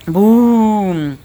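loud drawn-out boo; gritando. A narrator's reading. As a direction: shout it long to scare someone. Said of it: "E grita". (grito alto e prolongado para assustar)

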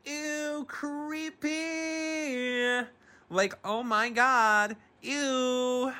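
high squealing disgust; squealing in disgust at a creepy line; grossed out. Real, not acted. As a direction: recoil with a high ew. Disgusted reaction to creepy scene.

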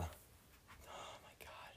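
slow, breathless whispered groan; embarrassed whisper. Real, not acted. Ashamed of stammering on camera, a slow embarrassed whisper. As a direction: whisper it to yourself in shame. Ashamed after stumbling over words.

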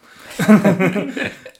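high, loud burst of laughter. Real, not acted. Laughs at own story.

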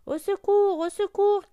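high, loud cries of alarm; cry for help. A narrator's reading. Duckling trapped in the ice, crying for help. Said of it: "cria-t-il".

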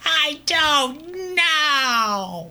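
very high, long-stretched cartoon wail; Chris from Family Guy. Cartoon boy answering where he went, high wailing impersonation. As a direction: wail it out, dragging every word way up high. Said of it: "That was a decent impersonation".

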